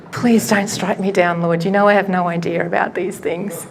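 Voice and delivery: usual speaking voice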